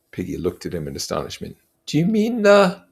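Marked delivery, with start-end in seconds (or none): 1.9-2.8 high, earnest schoolboy voice, slowing to insist; a fussy boy pleading for fairness; Piggy's voice. Acted. Piggy's line.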